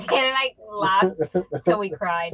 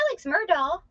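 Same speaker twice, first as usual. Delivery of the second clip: newsreader mimic; 7 semitones higher than usual. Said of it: "people on the news like".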